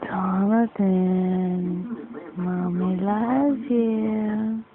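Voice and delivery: very slow, soft and low; tender. Real, not acted. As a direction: linger on each word, lovingly. Mother tenderly to baby.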